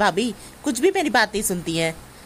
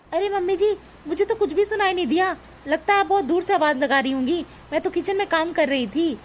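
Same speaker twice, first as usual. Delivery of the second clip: high-pitched innocent excuse; feigned innocence; 7 semitones higher than usual. Acted. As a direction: plead ignorance in a raised pitch. Daughter-in-law makes excuses to mother-in-law.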